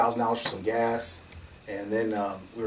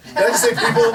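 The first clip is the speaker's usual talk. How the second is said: much higher and louder, laughing; louder laughing disbelief; 12 semitones higher, 10 dB louder than usual. Real, not acted. To the officials, laughing at their question, much higher and louder. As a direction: repeat it through laughter, high and loud.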